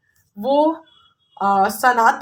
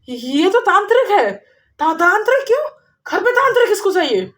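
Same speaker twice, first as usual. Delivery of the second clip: alarmed cry; 8 semitones higher, faster than usual. Acted. Daughter-in-law and mother alarmed.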